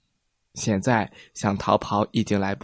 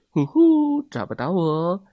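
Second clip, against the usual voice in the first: teasing call; 5 semitones higher than usual. A narrator's reading. Hide and seek game.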